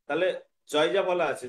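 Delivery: ordinary delivery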